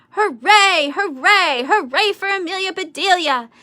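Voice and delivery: high, loud crowd cheer. A narrator's reading. Grizzlies cheering for Amelia Bedelia, high and loud. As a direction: roar out the hoorays. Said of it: "she heard a loud roar".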